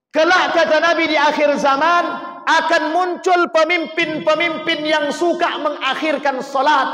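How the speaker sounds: high, loud proclamation of the hadith; loud preaching. Acted. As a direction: announce the warning to all. Hadith proclaimed loudly, then repeated calmly.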